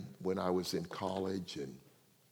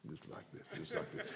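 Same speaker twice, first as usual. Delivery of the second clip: hushed demo; 5 semitones lower, 14 dB quieter than usual. Retold or imitated. Showing dad sitting hard like rock.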